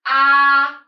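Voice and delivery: AH long, level and loud; learner's first tone. Shown as a demonstration. Teacher imitates students pronouncing by themselves, level loud AH. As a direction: hold AH loud on one unchanging note. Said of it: "they just pronounce".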